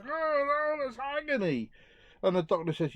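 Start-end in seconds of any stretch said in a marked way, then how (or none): 0.1-1.7 high, strained comic wail; hammed-up man in agony; agonised patient. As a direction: wail it like the pain is unbearable. Joke patient touches knee.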